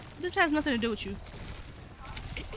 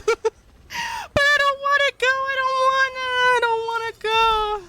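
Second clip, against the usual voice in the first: high, drawn-out whine; whiny; 12 semitones higher, 4 dB louder, slower than usual. Real, not acted. Whining about having to go.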